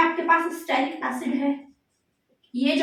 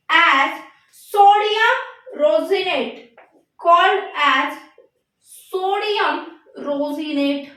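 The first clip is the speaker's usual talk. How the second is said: very slow, word-by-word dictation; much slower than usual. Real, not acted. Teacher dictates a soap definition to students, halting dictation. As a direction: isolate each word, leaving long writing pauses.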